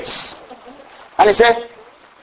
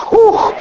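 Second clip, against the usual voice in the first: ecstatic whew. Real, not acted. Preacher overcome with praise.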